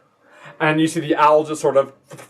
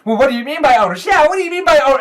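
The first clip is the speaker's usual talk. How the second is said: put-on high, loud, fast kid voices; rushed childish protest; indignant kids; 13 semitones higher, 8 dB louder, much faster than usual. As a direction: fire back an outraged question. Kids protest, talking over each other.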